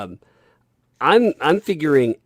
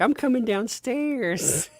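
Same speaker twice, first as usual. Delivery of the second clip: put-on very high, slow little-girl voice; imitating a toddler; 12 semitones higher, slower than usual. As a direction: announce it like a tiny child. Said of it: "And she's like".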